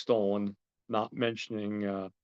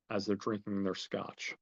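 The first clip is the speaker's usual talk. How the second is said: ordinary delivery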